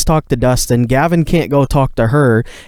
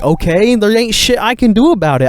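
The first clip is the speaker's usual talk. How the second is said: high, pitched-up protest that climbs; frustrated rant, voice climbing higher; exasperated venting; 7 semitones higher than usual. Real, not acted. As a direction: plead your innocence in rising exasperation. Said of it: "venting own innocence, rising".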